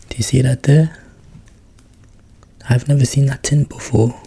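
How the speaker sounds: pointing it out with real delight; sudden excited exclamation; excited surprise. Real, not acted. Surprised at tin design.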